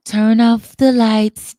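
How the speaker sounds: slow, loud crooner's purr. Retold or imitated. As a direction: croon it slow like a soul singer setting the mood.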